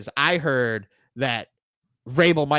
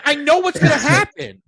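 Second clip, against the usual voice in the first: ordinary delivery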